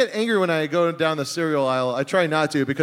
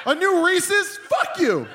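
higher outraged yell at a candy bar; mock rage; 11 semitones higher, slower than usual. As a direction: play furious, half laughing. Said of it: "I go".

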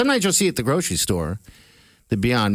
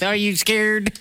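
robot voice; 9 semitones higher, slower than usual. Pretend. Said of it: "I love your robot voice".

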